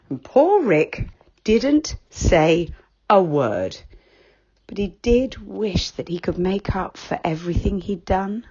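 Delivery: ordinary delivery, nothing marked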